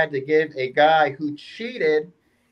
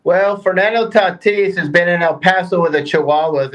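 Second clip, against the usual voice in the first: normal talking voice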